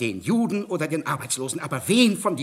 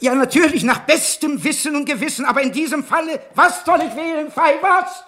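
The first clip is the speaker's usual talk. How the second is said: higher and louder, building to a desperate cry; rising panic; 12 semitones higher, 8 dB louder than usual. Acted. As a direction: plead louder and higher with each phrase. Judge panics, pleading with colleague.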